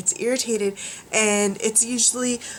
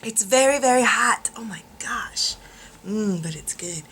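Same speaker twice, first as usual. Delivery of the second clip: whispering. Real, not acted. Sipping hot tea.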